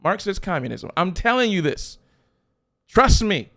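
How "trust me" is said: trust punched loud and high; forceful pulpit plea. Acted. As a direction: call it out to the back row. Pastor pleading with congregation.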